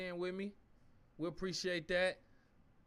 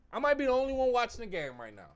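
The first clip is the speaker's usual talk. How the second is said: exasperated; 5 semitones higher, 7 dB louder than usual. Real, not acted. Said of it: "repeated lower".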